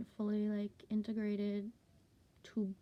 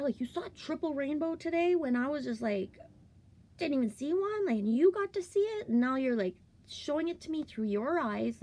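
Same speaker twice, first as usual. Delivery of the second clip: excited cheerleading; 6 semitones higher, 6 dB louder than usual. Retold or imitated. Mother cheering on her eight-year-old.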